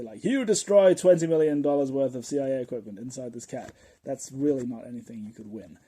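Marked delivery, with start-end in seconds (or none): 0.2-3.7 loud, declaimed charge; mock courtroom accusation. Prosecutor charges taxi driver on trial, loud mock accusation. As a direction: accuse loudly like a hammed-up prosecutor. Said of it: "on trial and say".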